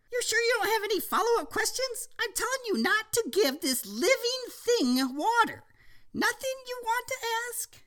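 put-on child voice. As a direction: warn like a serious kid. Boy character voiced.

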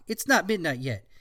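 ordinary talk

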